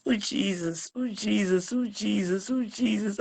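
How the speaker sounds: soft, repeated calling on Jesus; grieving plea. Real, not acted. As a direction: murmur it over and over as a prayer for strength. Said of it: "I don't call your name like this".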